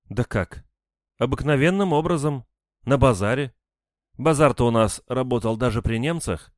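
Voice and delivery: high-pitched, animated woman's storytelling; chatty widowed woman. A narrator's reading. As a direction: justify yourself while recalling his charm. Narrator voices the woman recounting romance. (оживлённо, высоким женским голосом)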